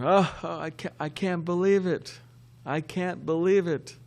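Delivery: put-on drunk laughing guru. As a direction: play the drunk guru cracking up at his success. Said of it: "he was laughing".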